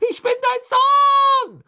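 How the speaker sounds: very high, drawn-out, desperate shriek; screamed. A narrator's reading. Boy screams up to Crouch, drawn-out desperate shriek. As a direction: scream it slowly with everything you have. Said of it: "schrie er zu Crouch hoch".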